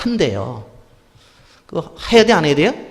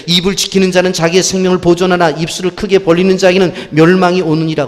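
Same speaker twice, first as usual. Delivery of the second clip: loud, raised scripture reading; 4 semitones higher, 8 dB louder than usual. Acted. Preacher reads Proverbs to the congregation, proclaiming loudly. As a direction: proclaim the verse from the pulpit.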